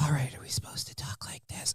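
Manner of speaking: exaggerated hushed whisper of a snarky kid; tiny, unvoiced-sounding kid's whisper; sarcastic whisper. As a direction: whisper it pointedly, mocking the request not to yell. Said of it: "Hey, don't yell".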